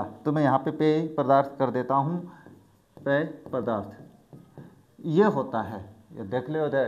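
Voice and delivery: usual speaking voice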